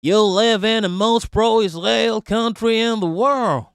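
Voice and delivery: a quick mocking Yankee accent. Mocking a commenter in a Yankee accent. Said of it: "let me try to do a Yankee accent".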